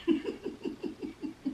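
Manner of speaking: quiet, voiceless chuckling; breathy chuckle. Real, not acted. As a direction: let out a soft run of giggles. Laughing at the car wash remark.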